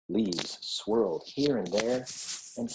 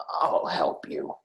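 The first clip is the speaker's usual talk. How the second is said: lower, slow, whispered reassurance; kindly; 7 semitones lower, slower than usual. A narrator's reading. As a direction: soothe him gently like a kind old badger. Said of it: "said Badger kindly".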